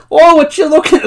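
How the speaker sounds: mock Midwestern, pitched high; Midwestern accent. Podcaster mimics the receptionist in the background, hammed-up Midwestern accent. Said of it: "she sounds so Midwestern".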